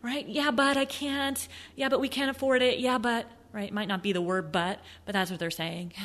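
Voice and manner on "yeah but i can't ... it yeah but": higher mock-whiny buyer excuses; hesitant buyer. Coach imitating buyers dodging the sale, mock whiny. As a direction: lightly voice a buyer backing out. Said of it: "They're always looking for a way out".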